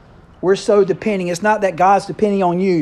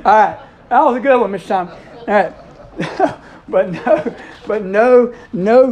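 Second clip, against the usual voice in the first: laughing; 5 semitones higher, 5 dB louder, slower than usual. Real, not acted. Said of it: "that's a pretty good one".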